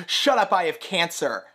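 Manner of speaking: hammed-up defiant comeback; mock snap. Rizzo covering her fallen wig to the cast, mock loud retort. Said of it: "turns to everyone on stage and goes".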